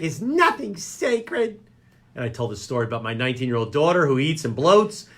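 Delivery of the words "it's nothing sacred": higher mock voice of an upset mother; mom voice. Son imitating his mother complaining about the poop story, mock mom voice. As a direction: imitate mom scolding, hammed up. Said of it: "She's like".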